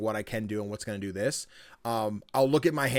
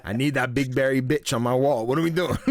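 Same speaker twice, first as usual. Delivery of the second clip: loud, cracking up while talking; laughing demand; 6 dB louder than usual. Real, not acted. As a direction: push it out through mounting laughter. Joking demand, breaking into laughter.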